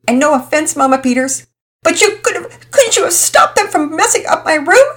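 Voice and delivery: indignant tearful daughter; blaming girl. Acted. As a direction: whine the blame at her. Girl blaming Mama Peters.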